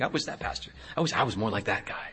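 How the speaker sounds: hushed, low confession of envy; private wish. Retold or imitated. Preacher admits envying another pastor, private hushed wish. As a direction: murmur a thought kept to yourself. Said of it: "I've said the same thing".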